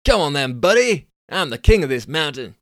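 loud, high cocky dude shout; Californian dude challenge. Acted. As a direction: bellow the challenge like a brash Californian. Billy challenging.

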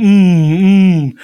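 usual speaking voice

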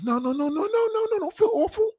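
very high squeaky no no no, comforting; hammed-up falsetto pleading not to feel bad; squealing over-the-top reassurance; mock reassuring. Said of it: "I'm like".

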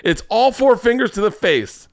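high, gleeful exclamation; excited. Real, not acted. Gleeful reaction to her hand move.

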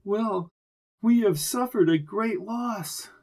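lightly shaky, grieving voice. A narrator's reading. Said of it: "spoke in a shaky voice".

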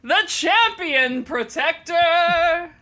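slow, sung herald announcement; grand announcer. Retold or imitated. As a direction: proclaim him like a herald. Announcing the mightiest of heroes.

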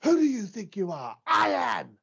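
high, loud boast building to a shout; imitated triumphant rant. Podcaster mimicking a skinny old bowler celebrating, triumphant shouted boast. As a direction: crow it wildly, mimicking a victorious bowler. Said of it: "He's like".

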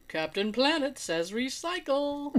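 slow, preachy announcer voice; mock announcer. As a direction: read it like a cheesy PSA. Mocking a cartoon slogan.

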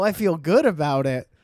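ordinary talk, nothing marked

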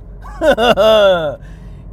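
a loud, rolling belly laugh; loud laughter; hearty laugh. Real, not acted. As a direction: laugh big at your own punchline. Laughs after his punchline.

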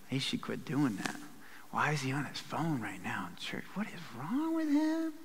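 hushed mock whisper; gossipy whisper. Preacher playing a gossiping churchgoer, hushed whisper.

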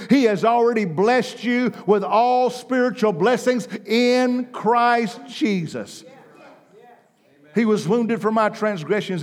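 loud, high, ringing sermon voice; full-force preaching. Acted. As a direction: preach it out to the crowd.

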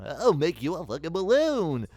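high, loud, slow clown offer; jolly clown. Retold or imitated. Describing Tim Curry's clown, jolly loud clown voice. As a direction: play the happy-go-lucky clown.

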